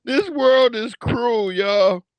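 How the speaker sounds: high, slow mock despair; mock lament. Real, not acted. Streamer mock-lamenting to viewers, slow and high. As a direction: sigh it out theatrically.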